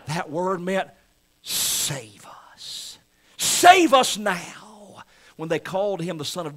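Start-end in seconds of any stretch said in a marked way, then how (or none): 1.4-3.0 breathy whispered save us; hushed pleading whisper; whispered plea. Acted. Preacher voices crowd's plea. 3.4-5.0 drawn-out, louder, higher cry of save us now; slow ringing plea. Acted. Preacher voices crowd's plea.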